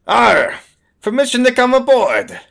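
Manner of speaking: high, loud pirate hail; pirate diplomat. Acted. Pirate hails the captain to come aboard, hearty pirate call. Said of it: "she says".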